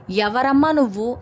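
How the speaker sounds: plain reading voice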